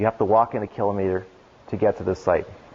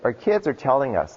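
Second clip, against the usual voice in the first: normal talking voice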